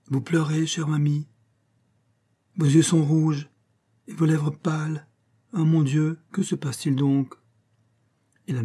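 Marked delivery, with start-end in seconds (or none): 0.1-7.3 a fretting noblewoman; concerned marquise. A narrator's reading. Marquise fusses over her tearful friend, high worried voice. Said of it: "s'écria madame de Prie".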